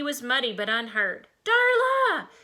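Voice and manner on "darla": high, loud searching cry; long loud high-pitched call. A narrator's reading. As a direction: holler for a missing friend. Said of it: "Freddie called out".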